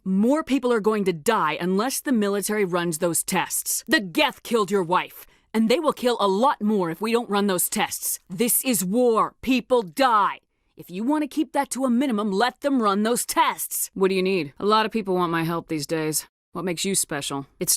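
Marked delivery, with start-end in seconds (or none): 3.9-13.9 forceful pleading. Acted. Pressing grieving husband to allow tests.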